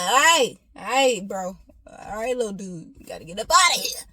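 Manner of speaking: loud mock "time to go" urging, quickening at the end; hammed-up eviction of an unborn baby; playful send-off call. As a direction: call out to a little buddy, bursts of volume.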